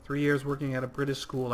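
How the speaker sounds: usual speaking voice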